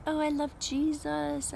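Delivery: high, airy, sugary devotion she mocks; mock ethereal gush. Mocking an over-spiritual believer, high and dreamy. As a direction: swoon the name in a breathy, dreamy pious lilt. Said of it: "I don't mean it, like, weird and, like, ethereal".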